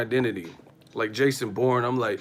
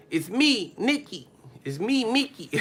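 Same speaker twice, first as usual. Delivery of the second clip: higher squeaky imitation of a rapper; 9 semitones higher than usual. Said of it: "sound like [name]".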